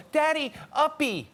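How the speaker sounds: put-on high little-kid baby talk. Dad imitating his eight-year-old begging to be carried, babyish. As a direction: beg like a toddler wanting up. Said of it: "my kids go".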